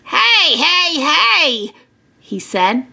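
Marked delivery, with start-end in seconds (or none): 0.0-1.7 higher-pitched interrupting hey; mildly cross farmer; scolding. Acted. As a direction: cut off teasing kids. Angry farmer scolds teasing boys.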